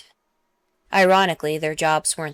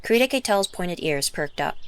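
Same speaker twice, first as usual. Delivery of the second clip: ordinary delivery, nothing marked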